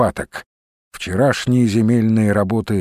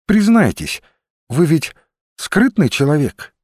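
higher-pitched woman's voice, candid and pressing; frank, probing Olga; 6 semitones higher than usual. Acted. Olga tells Rusinov he seems secretive, frank and probing. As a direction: pitch it up and press him to admit it.

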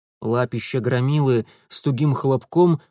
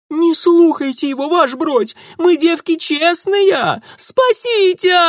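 shrieking plea, much higher and louder; shrill squeal; 7 dB louder than usual. A narrator's reading. Maryanka squeals into the bear's ear for rescue. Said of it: "взвизгнула Марьянка".

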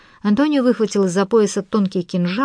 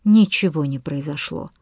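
usual speaking voice

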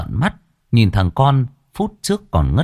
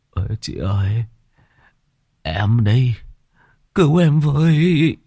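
moaning plea; 3 semitones higher than usual. Acted. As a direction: groan for help like he's hurt. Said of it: "mồm rên rỉ".

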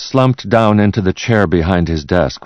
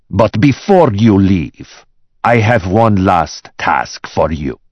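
slow, raised, threatening order; menacing boss; 4 semitones higher, 4 dB louder, slower than usual. Acted. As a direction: draw out one last command. Boss threatens fired secretary.

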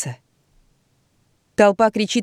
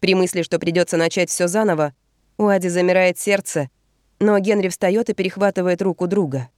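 usual speaking voice

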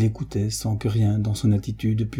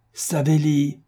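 high commanding call; a master's booming call; thunderous voice; 6 semitones higher, slower than usual. A narrator's reading. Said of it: "d'une voix de tonnerre".

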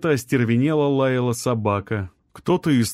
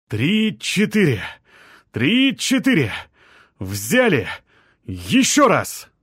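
heaving shouts; 6 semitones higher, slower than usual. A narrator's reading. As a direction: chant the effort with each heave. Men heave the slab together.

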